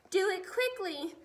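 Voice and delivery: frantic giant shout. A narrator's reading. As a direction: yell it out in a panic. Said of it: "shouted the flesh lump eater".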